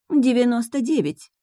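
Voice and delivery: high-pitched prim correction; pointed correction. A narrator's reading. Witch correcting the heroine's count of wives, pointed correction. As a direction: correct her figure pointedly. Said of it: "поправила Урсула".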